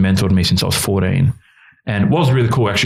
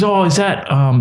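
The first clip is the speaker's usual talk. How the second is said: higher-pitched imitated curious kid; lightly voiced excited child; 10 semitones higher, slower than usual. Bella in the car seeing the food, lightly imitated excitement.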